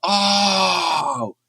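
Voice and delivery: long, high drawn-out "ohhh"; whole-room "ohhh". Retold or imitated. Whole room of kids reacts to his answer, long imitated "ohhh". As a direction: stretch a high "oh" like a crowd. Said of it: "the whole room went".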